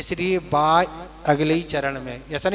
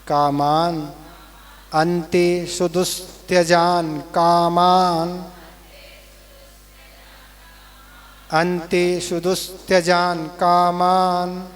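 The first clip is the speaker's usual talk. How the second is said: each word spaced out and held, slowly; slow syllable drill; slower than usual. Shown as a demonstration. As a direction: break the line into slow separate words for students to copy. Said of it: "तीसरे चरण".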